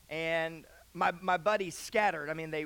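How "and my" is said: ordinary delivery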